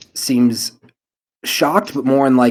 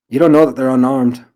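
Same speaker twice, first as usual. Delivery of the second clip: gruff father; 3 semitones higher, 9 dB louder than usual. Acted. Father character challenges the player.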